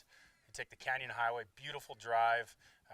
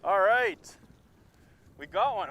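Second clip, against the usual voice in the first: loud, high celebration; excited cheer; 12 semitones higher, 16 dB louder, much slower than usual. Real, not acted. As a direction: cheer the catch at full voice. Fish landed, celebrating.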